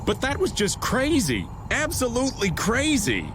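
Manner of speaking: high, incredulous exclamation; exasperated disbelief. Acted. Older companion on Carol's reckless stunt, exasperated. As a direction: swoop up high on the complaint about crazy behaviour.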